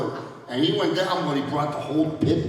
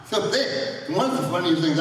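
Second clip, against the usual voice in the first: ordinary talk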